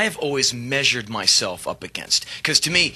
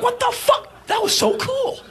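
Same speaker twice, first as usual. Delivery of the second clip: much higher, excited squeal; amazed roadie. Retold or imitated. Roadie watching the keyboard smash, high excited cry. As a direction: gush in disbelief. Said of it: "And the roadie's like".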